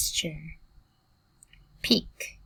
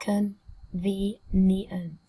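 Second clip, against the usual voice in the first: very slow CON-VE-NIENT, split into syllables; much slower than usual. Shown as a demonstration. Teacher modelling a new word for students, slowly syllable by syllable. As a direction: sound out Convenient slowly, pausing between syllables. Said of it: "Cô giáo đọc chậm nhá".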